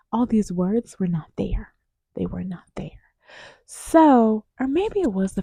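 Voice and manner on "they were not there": a dropped whisper repeating the point; whispered aside. Real, not acted. Whispering the point to viewers. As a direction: breathe it out, barely voiced.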